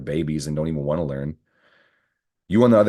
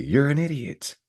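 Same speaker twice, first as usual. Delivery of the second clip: a hint of a scolding TV judge, pitched much higher; lightly mock-sentence someone from the bench; Judge Judy; 9 semitones higher than usual. Imitating a TV judge calling someone an idiot, lightly voiced. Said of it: "I'm on my Judge Judy".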